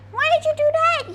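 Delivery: put-on pet baby talk. Baby-talks to her dog.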